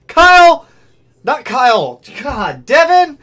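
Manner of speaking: loud, high, rapid-fire greetings to chat; excited shoutouts. Real, not acted. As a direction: shout out each name with real excitement. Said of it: "I got excited".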